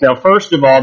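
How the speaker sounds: ordinary talk, nothing marked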